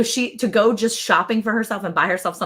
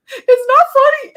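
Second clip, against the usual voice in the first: high, loud, drawn-out whine; mock tearful wail; 9 dB louder, slower than usual. Mother-in-law protesting at being laughed at, mock tearful wail. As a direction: imitate a crying protest, squealed slowly. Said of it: "she's physically crying on the phone".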